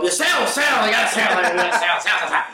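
high, wired, laughing boss voice pushing sales; hammed-up manic boss chanting sell; mock coked-up manager, high and giggly. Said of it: "And he would come up".

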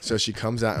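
usual speaking voice

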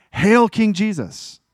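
high, loud, slow royal acclaim; proclaimed acclaim. Retold or imitated. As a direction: hail him like a king before a crowd. The acclaim they should have given.

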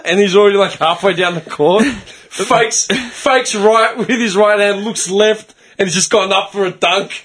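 usual speaking voice

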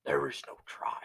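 low whispered Yoda line. Pretend. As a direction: breathe it out like old Yoda.

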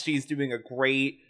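ordinary delivery

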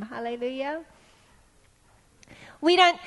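ordinary talk

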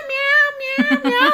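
high, squeaky kitten meows. Retold or imitated. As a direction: mew like a lost kitten. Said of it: "this cat's walking up this hill and just".

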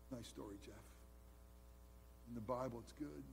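soft, deadpan dismissal; quiet, low sarcastic brush-off; mock stoic shrug. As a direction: say it as an unmoved churchgoer, barely voiced. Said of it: "We should be stoic".

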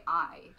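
"I" with a clear two-part glide; northern, TV-style "I"; full "I". Shown as a demonstration. Showing how people on TV say "I". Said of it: "they say".

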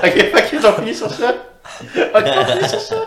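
laughing through the words; giggly, can't stop laughing. Real, not acted.

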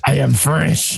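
mock French accent, lightly done; bad French accent. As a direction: imitate Picard's hammy French accent. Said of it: "really bad French accent".

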